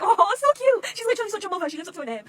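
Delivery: high, loud, adoring gush; squeal, overcome by cuteness; real fangirl squeal. Real, not acted. Squealing over motherly scene.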